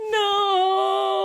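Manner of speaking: high, loud tantrum cry of no; crying shout. Acted. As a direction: wail it like a sulking bird. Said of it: "now he's pouting and crying".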